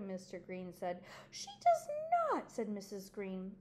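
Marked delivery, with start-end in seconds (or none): 1.3-2.5 high, loud indignant protest; wife flatly contradicting her husband; indignant denial. Acted. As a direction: snap back in protest.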